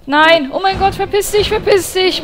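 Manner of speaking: high panicked yell with the voice breaking; screaming at the boss until the voice gives out; voice cracking. Real, not acted. Player yelling at an attacking boss, voice breaking. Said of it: "Meine Stimme versagt!".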